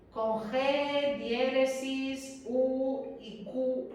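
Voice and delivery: Con G, diéresis, U y Q spelled letter by letter, very slow; spelled out slowly. Shown as a demonstration. Teacher dictating the Spanish spelling of whisky, slowly. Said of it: "Así como suena".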